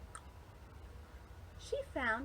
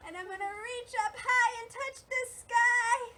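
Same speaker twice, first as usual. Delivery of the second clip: rising, high singsong; high, excited lilt; reaching up high; 9 semitones higher, 3 dB louder than usual. Shown as a demonstration. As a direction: let the voice stretch up to the sky. Voice climbs with reaching up.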